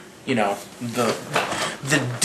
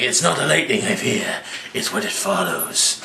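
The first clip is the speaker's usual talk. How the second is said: hammed-up foreboding; mock ominous villain; 4 semitones lower than usual. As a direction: trail down into a hushed, sinister line. Voicing Loki's ominous reply.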